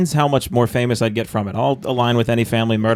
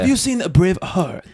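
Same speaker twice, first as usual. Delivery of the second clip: Bobby Cody; 5 semitones higher than usual. Retold or imitated. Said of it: "like, Scottish accent where he's like".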